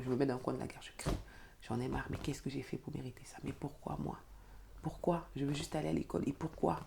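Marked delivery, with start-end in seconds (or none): 1.7-6.8 chuchote son désespoir, très doucement; désespoir chuchoté. Retold or imitated. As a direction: pleure intérieurement dans un coin de la gare. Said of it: "Je hurle". (désespoir chuchoté, très doux)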